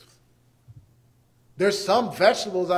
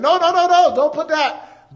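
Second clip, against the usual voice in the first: high, loud no no no; mother snatching something from her son, alarmed; scolding parent; 8 semitones higher, 10 dB louder than usual. Retold or imitated. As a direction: stop the child fast. Wife stopping son.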